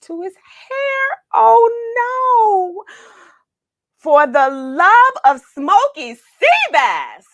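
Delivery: high, loud horrified cry; alarmed dismay. A narrator's reading. Narrator shocked as Samson's hair comes off. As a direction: build from breathless gasp to shout. Hat pulls off hair disaster.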